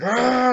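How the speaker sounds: loud, high mock yell; sudden hammed-up holler; imitated yell. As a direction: burst out with a put-on high scream. Imitates character's yell breaking thing.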